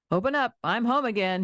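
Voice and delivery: farmer calling out high and cheerful toward the house; a hearty call to open the door; shouting. A narrator's reading. Farmer shouting to his family as he arrives home. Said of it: "he shouted".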